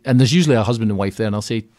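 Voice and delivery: ordinary delivery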